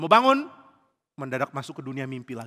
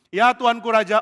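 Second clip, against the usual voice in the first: high, loud answer; raised, respectful holler; calling reply; 7 semitones higher, 9 dB louder than usual. Acted. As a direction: call back dutifully to the king. Daud menyahut raja dari jauh.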